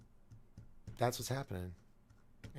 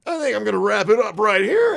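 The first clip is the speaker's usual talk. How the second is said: higher, louder radio-host voice; Rush Limbaugh impression; 12 semitones higher, 14 dB louder than usual. Host signs off mimicking a radio talker. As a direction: boom it out like a talk-radio host. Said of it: "as Rush Limbaugh would say".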